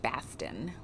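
Baston with a flat broad a, drawn out a little; Boston said as Baston. Shown as a demonstration. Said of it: "I don't know how to do a proper Boston accent".

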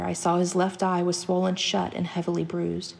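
plain reading voice

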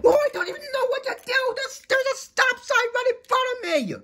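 very high, frantic wail; frantic high-pitched protest; panicked outburst. Acted. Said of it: "Mater, calm down".

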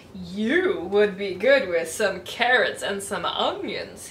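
muttered fox. Acted. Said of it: "he muttered".

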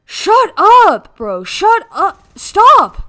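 very loud, high shouts; exasperated shouting. Real, not acted. Silencing the other player.

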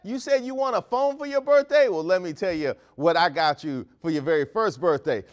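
ordinary talk

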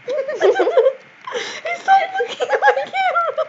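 high, slow, laugh-choked words; laughing hard. Real, not acted. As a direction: squeeze the words out through laughter. Laughing at friend facing the camera.

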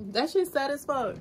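high, teary and choked; real weepy complaint; tearful. Real, not acted. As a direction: say it while tears come. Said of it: "I'm really crying on camera".

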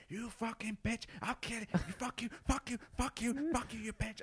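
high-pitched imitated raging yell; berserk rage. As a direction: rage at the passing train. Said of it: "a string of expletives and violence".

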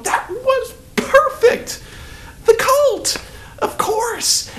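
very high, slow, laughing rapture; gushing delight. Retold or imitated. Leaving the theater thrilled, high laughing delight. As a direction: swoon over the perfect ending. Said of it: "I walked out of there going".

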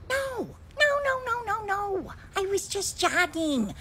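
very high, loud panicked no; shrieking protest. Acted. Woman accused of kidnapping shrieks her denial at the officer.

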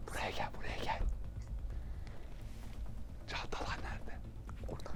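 whispering while hiding. Real, not acted. Hiding from security guard.

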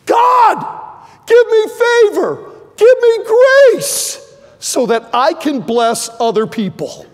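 high, loud desperate shout; crying out to God. Retold or imitated. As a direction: cry out with everything you have. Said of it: "Jabez has got this".

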